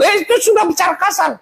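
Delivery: high, amused gotcha; mock surprise. Real, not acted. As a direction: catch them out with a laugh. Pointing out the irony. (kaget pura-pura)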